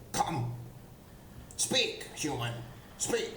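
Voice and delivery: a hint of a goblin boss calling out, pitch jumping up on speak; goblin boss shouting. Goblin boss summoning the human, lightly voiced shout. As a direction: bark the command like a goblin chief. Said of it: "it shouts out".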